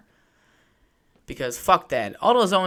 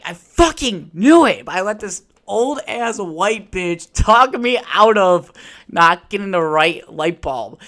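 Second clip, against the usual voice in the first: exasperated outburst; 5 semitones higher, 7 dB louder, slower than usual. Real, not acted. Vindicated about the bulb.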